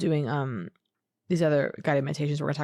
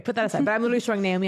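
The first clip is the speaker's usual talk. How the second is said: ordinary talk, nothing marked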